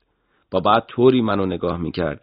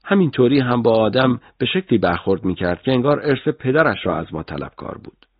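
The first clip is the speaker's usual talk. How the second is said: usual speaking voice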